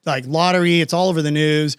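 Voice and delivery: usual speaking voice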